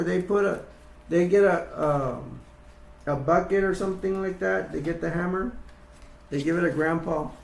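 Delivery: ordinary delivery, nothing marked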